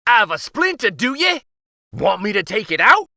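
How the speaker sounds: put-on high, loud gleeful old man; spry old uncle. Uncle greeting the king, high eager old man.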